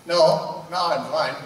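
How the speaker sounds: normal talking voice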